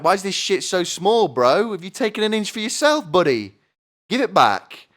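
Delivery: high, loud mock outrage at dad; mock accusation. Comic son yelling at his dad over a stolen inch, high and loud. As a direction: yell at dad, hammed-up and high.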